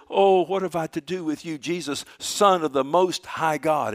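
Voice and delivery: loud, high cry of protest; demon crying out. Retold or imitated. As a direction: wail the words out to Jesus. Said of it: "with a loud voice".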